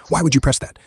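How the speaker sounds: high-pitched, understated yell; storyteller's mild mock yell; narrated yell. A narrator's reading. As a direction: exclaim it high, without real shouting. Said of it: "Western yells".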